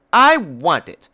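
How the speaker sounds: imitated child tantrum; whiny child. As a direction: whine like a screaming toddler. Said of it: "the screaming child".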